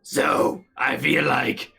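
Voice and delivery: slow rasping ghoul voice. Acted. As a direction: drag it out like a ghoul. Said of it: "but in my ghoul voice".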